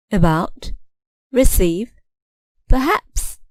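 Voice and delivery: "about, receive, perhaps" each stressed on the final syllable. Shown as a demonstration. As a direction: model "about, receive, perhaps" word by word, last syllable prominent. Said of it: "The final syllable is stressed".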